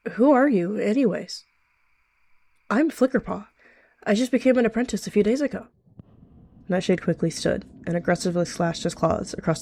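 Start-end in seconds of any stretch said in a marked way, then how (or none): 0.1-5.7 lightly voiced, higher young cat; innocent, curious youngster with a lifted pitch; chatty young apprentice. A narrator's reading. Young cat introducing herself to a stranger, chatty and innocent.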